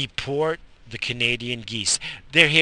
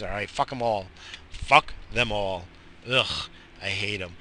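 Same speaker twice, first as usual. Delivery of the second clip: usual speaking voice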